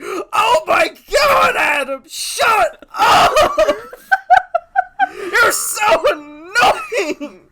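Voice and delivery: high, loud, slow screaming outburst, laughing through it; a hammed-up tantrum yell; mock blow-up. Jake blows up at Adam at lunch, mock screaming tantrum. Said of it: "Jake just blows up on him".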